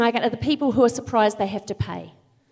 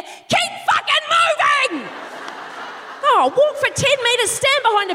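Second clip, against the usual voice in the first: yelled at full volume, much higher; angry shout; 10 semitones higher than usual. Real, not acted. Comic blocking escalator crowd, furious shout. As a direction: bellow it at the people blocking the escalator.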